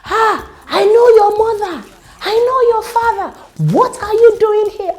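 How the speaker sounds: high, loud scolding yell; retold shout. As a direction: shout her down like an angry stranger. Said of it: "there was this woman there shouting".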